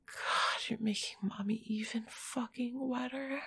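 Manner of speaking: usual speaking voice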